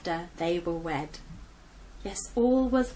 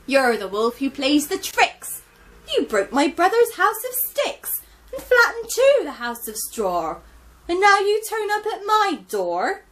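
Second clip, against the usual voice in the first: accusing piglets; 10 semitones higher, 9 dB louder than usual. Acted.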